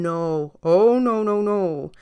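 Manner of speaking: a mother shutting it down; mom refusing. A narrator's reading. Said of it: "the mom saying".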